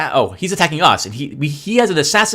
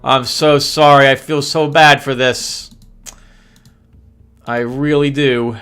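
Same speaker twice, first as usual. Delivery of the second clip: louder, hammed-up apology; mock sympathy; 4 dB louder than usual. Gamer hamming up sympathy for the crushed enemy. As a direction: overplay the sorry, barely hiding glee.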